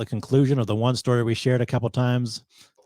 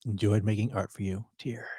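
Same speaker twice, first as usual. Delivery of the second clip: hushed echo, nearly all breath; soft, whispered aside; mock-tearful whisper; 2 semitones lower, 6 dB quieter than usual. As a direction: whisper it as a little tribute. Echoes farewell letter, adds "Tear.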